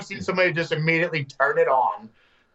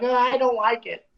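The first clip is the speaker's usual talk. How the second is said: playful self-mocking complaint; mock whining; 8 semitones higher than usual. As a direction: whine it like a lonely little kid. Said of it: "and I was like".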